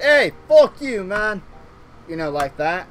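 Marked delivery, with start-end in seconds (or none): 0.0-1.4 high, loud mock confrontation; mock angry yell. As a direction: bark it out as a sudden attack. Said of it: "if someone just randomly went".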